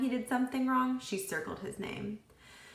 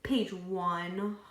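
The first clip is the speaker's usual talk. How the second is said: sighed. A narrator's reading. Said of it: "Joy sighed".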